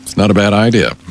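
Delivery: ordinary delivery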